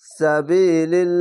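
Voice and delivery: drawn-out chanted reading of তাকুলু, vowels held long; slow Quran recitation. Shown as a demonstration. Teacher demonstrating tajweed to students, slow chanted recitation. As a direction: recite তাকুলু slowly, stretching each vowel. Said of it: "এক আলিফ টেনে পড়বেন".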